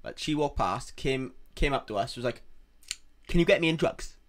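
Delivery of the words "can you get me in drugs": put-on a light take on the homeless girl's Swansea accent, a touch louder; local accent imitation. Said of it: "Like, in, in that accent".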